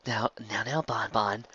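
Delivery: soft, calming pleading; calm her down gently; soothing. Acted. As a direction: Lyra trying to soothe Bon Bon. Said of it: "Lyra tried".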